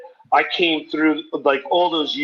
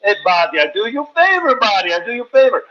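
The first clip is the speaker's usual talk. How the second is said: pushy owner; 5 semitones higher, faster than usual. Retold or imitated. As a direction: sell the favor like a slick boss. Owner voiced in retold story.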